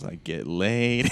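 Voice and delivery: very drawn-out mock boast; happy boast. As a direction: stretch it out with relish, imitating him. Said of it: "He's the happiest homeless guy ever".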